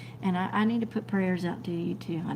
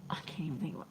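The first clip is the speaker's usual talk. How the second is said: whisper; 3 semitones lower, 8 dB quieter, much faster than usual. Real, not acted. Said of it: "my brain is so not working".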